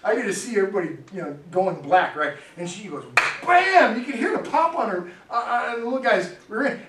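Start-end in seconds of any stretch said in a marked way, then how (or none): 3.4-4.0 high, loud imitated slap; smack sound. Pastor imitating the mom's spank on the boy, a loud smack. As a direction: snap out the slap noise.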